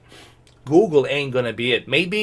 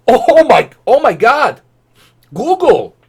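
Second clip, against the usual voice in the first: loud high outburst of disbelief; shocked; 10 semitones higher, 9 dB louder than usual. Real, not acted. Reacting to Google's ad, loud shocked disbelief. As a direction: explode in stunned disbelief.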